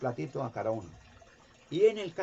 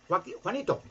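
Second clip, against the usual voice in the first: higher, louder name call; calling a dog; 7 semitones higher, 4 dB louder than usual. Retold or imitated. Experimenter calling each dog, raised call.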